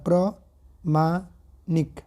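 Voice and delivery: प्रमाणिक stretched out syllable by syllable; very slow dictation. Shown as a demonstration. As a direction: drag out प्रमाणिक for students. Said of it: "प्रमाणिक होता है".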